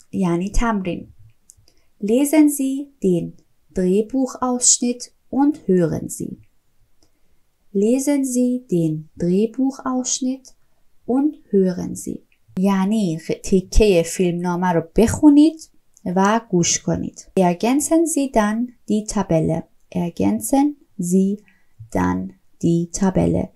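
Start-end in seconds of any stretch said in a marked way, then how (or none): 2.0-12.2 slow, careful German instruction; slow instruction read. Shown as a demonstration. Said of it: "reads task instruction slowly". 17.4-23.5 slow, careful German instruction; slow instruction read. Shown as a demonstration. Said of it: "reads instruction slowly".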